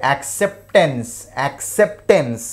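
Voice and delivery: "యాక్సెప్టెన్స్" slowly, with the P before T clearly sounded. Shown as a demonstration. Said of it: "P స్పష్టంగా పలుకుతాం".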